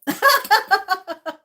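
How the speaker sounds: loud high laugh; big loud laugh. Real, not acted. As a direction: burst out laughing loudly. Laughing after loving disasters.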